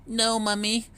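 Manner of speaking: put-on baby duck refusing in fear; scared duckling. A narrator's reading. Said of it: "cried Waddle".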